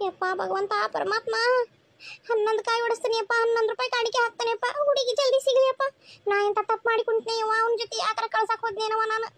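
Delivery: rushed, pleading vow to god; pleading prayer. Acted. Worried mother begging god for her son's bride, pleading prayer. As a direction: beg the god fast, bargaining with offerings.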